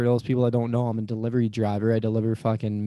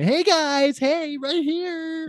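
much higher, slow sing-song hello; petty mock greeting; 3 dB louder, much slower than usual. Driver imagining greeting the rude staff in a high, slow sing-song. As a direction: wave and sing it out to annoy them.

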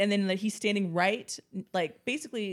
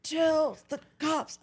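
high slow strained plea; slow dying man; 7 semitones higher, slower than usual. Retold or imitated. Bloodied man begs the girls, slow high strain. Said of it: "he's like".

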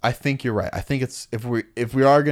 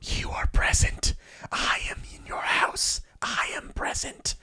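eerie unvoiced whisper; 8 dB quieter than usual. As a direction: breathe it like a creepy intruder. Creepy alien voice in retold show.

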